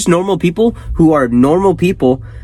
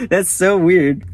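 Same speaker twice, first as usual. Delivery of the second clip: ordinary talk, nothing marked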